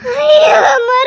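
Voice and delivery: loud cry of dismay; distraught, drawn-out lament; panicked wail. Acted. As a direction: cry out in anguish. Panic on hearing two hours.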